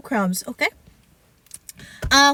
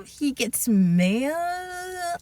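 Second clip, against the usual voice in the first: ordinary talk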